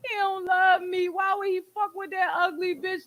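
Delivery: lightly hammed-up wounded protest; emotional upset. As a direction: voice a jealous partner's upset reaction. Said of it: "we're emotional, so we're gonna take it as".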